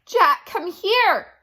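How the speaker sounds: loud, high, slow call. A narrator's reading. Annie calls Jack from across the hill, loud slow call. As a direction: draw it out to reach him up the hill. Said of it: "Annie called".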